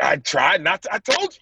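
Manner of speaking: retold protest, rising as patience runs out; getting upset. Athlete retelling his pushback to his counselor, increasingly upset. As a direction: pitch climbing in mock exasperation. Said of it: "I'm getting upset".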